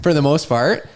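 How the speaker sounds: high, fast indignant protest; mock defensive, high and quick. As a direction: leap up in pitch, hurt pride. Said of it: "I got defensive".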